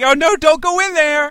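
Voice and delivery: high, very loud drawn-out holler; imitating a heckler shouting out during a horror movie; shouting moviegoer. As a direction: yell a panicked warning at the screen. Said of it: "the ones that would just shout".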